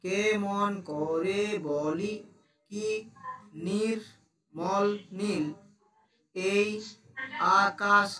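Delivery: slow, drawn-out poem reading; poem recitation. Shown as a demonstration. Teacher reciting a poem slowly to pupils, drawn out. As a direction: recite each phrase slowly to the class.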